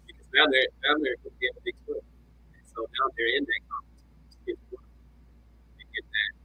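mic cutting in and out; choppy, dropping audio; uneven volume. Real, not acted. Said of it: "So your mic is cutting out".

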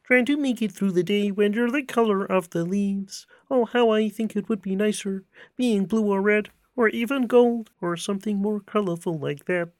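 high, far-off frog puppet voice, slowed on the famous line; sing-song lightly croaky frog voice; Kermit the Frog. Acted. Asked on the podcast to do Kermit, high frog voice. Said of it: "Yeah, go do it".

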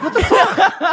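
high, loud laughing what the; shocked laughing outburst. Retold or imitated. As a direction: burst out in amused disbelief. Waking up on empty train.

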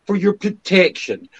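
high, loud mock official spin; sarcastic Newspeak mockery. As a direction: sneer it like government doublespeak.

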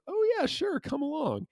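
high, sing-song friendly witch; cheery witch voice. Retold or imitated. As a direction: sweetly invite them along, way up high. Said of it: "And the witch goes".

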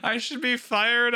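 high, wailing mock sob as Hagrid; mock crying Hagrid. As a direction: play Hagrid blubbering, hammed-up. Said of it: "he's crying, and he's like".

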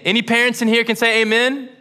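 calling out. Real, not acted. Preacher calls for amen.

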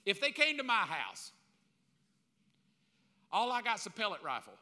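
ordinary delivery

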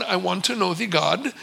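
low, soft imitated Indian accent; thick Indian accent. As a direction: put on an Indian accent, quietly earnest. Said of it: "in a very thick Indian accent".

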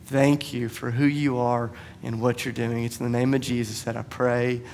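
soft, slow, reverent prayer; closing prayer. Real, not acted. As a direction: pray quietly over the congregation.

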